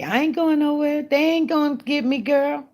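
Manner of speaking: high-pitched defiant boast; sing-out sassy refusal; playful defiance. Real, not acted.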